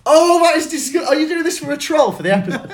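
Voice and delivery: high, loud, disbelieving protest; playful outrage. Real, not acted. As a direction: object loudly as if scandalised, half laughing. Mock outrage in a word argument.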